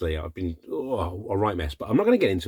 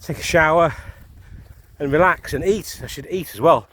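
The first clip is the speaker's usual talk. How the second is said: breathless after the uphill climb; out of breath; 4 semitones higher, 5 dB louder than usual. Real, not acted. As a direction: talk while catching your breath. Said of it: "my heart is about to explode out of my chest".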